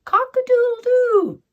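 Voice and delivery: rooster crow. A narrator's reading. Rooster puffs up his chest and crows loudly at sunrise. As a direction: crow like a proud rooster at dawn. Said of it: "he called out aloud".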